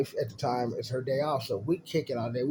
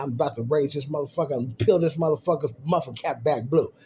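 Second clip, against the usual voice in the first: ordinary talk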